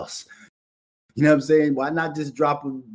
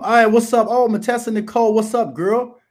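loud, higher-pitched hello to a friend; excited greeting; 8 semitones higher, 9 dB louder than usual. Real, not acted. Host greeting an old friend who popped in the chat, excited. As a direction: greet her loudly as she pops in.

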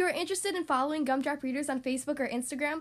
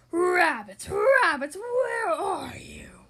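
howled call; 3 semitones higher, 8 dB louder, much slower than usual. Acted. Wolf Boy calling for rabbits.

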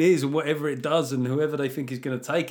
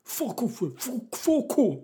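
put-on high, stretched kid yelling swears; twelve-year-old's swearing; 9 semitones higher, much slower than usual. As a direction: imitate a boy telling off the ref. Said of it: "He went".

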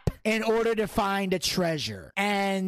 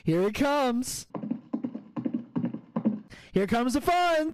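hyped buildup; 5 semitones higher than usual. Pretend. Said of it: "building up the happy rant".

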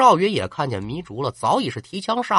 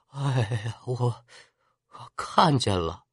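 faint wounded voice; 4 semitones lower, 7 dB quieter, much slower than usual. Acted. Wounded Jian Yong barely conscious.

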